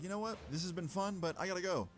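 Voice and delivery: breezy fake politeness while bailing; mock cheerful goodbye.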